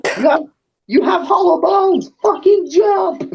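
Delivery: high, slow screaming demands; screaming at the bird. Acted. DM screaming at the stubborn bird to jump off. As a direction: shriek at them to jump.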